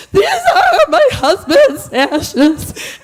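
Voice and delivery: slow, loud, laughing-through-tears wail; tearful announcement. Retold or imitated. Widow at airport security, tearful slow wail. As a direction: drag it out, half sobbing, half laughing. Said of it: "and I go".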